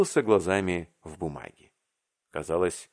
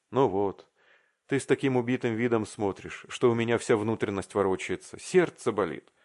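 ordinary delivery, nothing marked

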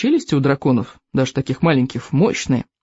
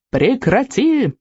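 slow, higher mock yell; 8 semitones higher, much slower than usual. A narrator's reading. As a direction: yell at the dragon to stop. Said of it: "завопил Икинг". (мягкий крик)

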